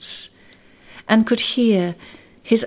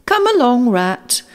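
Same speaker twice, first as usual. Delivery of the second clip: raised voice, higher, calling to a friend; 5 semitones higher, 5 dB louder than usual. A narrator's reading. Mole calls Rat to come along, raised calling voice. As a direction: call him along, louder. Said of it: "called the Mole".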